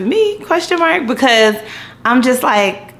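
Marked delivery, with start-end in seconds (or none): 0.0-1.1 unsure. Retold or imitated. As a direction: ask it in a high squeak. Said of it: "title of my autobiography".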